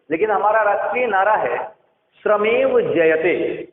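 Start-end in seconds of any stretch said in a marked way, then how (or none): 2.2-3.7 श्रमेव जयते said slowly, syllable by syllable; slow dictation of श्रमेव जयते; careful pronunciation. Shown as a demonstration. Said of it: "ध्यान रखिएगा".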